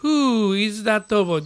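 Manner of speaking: slow, stress on "Who"; teacher's slow example sentence; slow model reading. Shown as a demonstration. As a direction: open with a clear "Who". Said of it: "今日の文章のとこに戻ってください".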